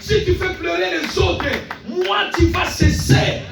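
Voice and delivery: forceful declaration. Real, not acted. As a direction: command the trouble to stop, at full force. Commands the situation in declaration. (proclamation criée avec autorité)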